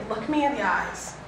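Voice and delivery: normal talking voice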